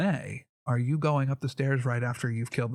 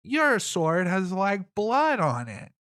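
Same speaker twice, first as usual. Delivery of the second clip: higher, slow guard's challenge; voiced guard; 7 semitones higher, 3 dB louder, slower than usual. Guard questioning a newcomer's sword, lightly voiced and higher. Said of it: "you hear a guy yell out".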